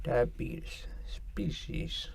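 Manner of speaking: put-on breathy whisper of an old man's voice; whispered impression. Podcaster mimicking the diabetes guy saying species, whispered. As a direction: whisper each word slowly like the ad guy. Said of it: "That's how he would say it".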